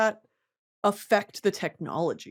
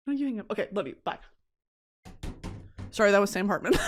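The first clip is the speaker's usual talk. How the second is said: usual speaking voice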